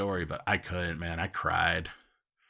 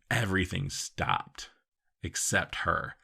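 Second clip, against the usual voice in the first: ordinary talk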